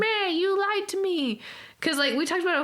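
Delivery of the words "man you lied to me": high, whiny complaint; mock whiny. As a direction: whine it like a sulking heroine. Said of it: "she's really whiny".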